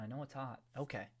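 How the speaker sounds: ordinary delivery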